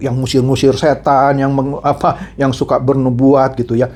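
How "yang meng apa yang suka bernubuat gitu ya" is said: usual speaking voice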